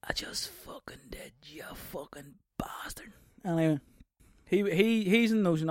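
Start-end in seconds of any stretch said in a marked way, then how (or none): 0.1-3.1 hushed, slow whispered taunt. Pretend. Answering offended listeners, whispered. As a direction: hiss the comeback softly.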